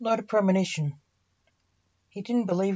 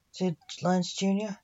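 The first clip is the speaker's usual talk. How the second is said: usual speaking voice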